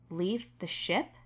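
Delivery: ordinary delivery, nothing marked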